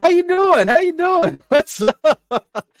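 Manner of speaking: high loud repeated hello; excited greeting. Real, not acted. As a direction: greet the newcomer with a cheer. Host greets a viewer.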